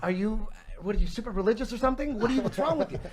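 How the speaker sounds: high-pitched, baffled questioning; incredulous challenge. Retold or imitated. Retold challenge to his buddy.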